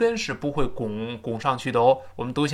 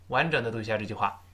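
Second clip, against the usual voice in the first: ordinary delivery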